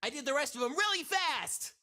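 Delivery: high, loud, fast cartoon hedgehog voice; Sonic. Chat requested pi digits, he answers as Sonic. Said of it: "in Sonic's voice".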